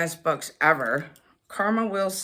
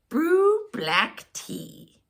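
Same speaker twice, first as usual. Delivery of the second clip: usual speaking voice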